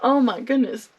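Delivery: imitating a crush who can't stop thinking of you; mock gushing crush. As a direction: play the smitten young admirer pouring it out. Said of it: "They're like".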